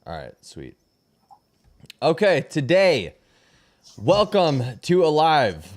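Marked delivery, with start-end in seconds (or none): 2.0-5.7 louder, slow host welcome; show host intro. Acted. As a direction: open the show like an announcer. Stream host opening the show.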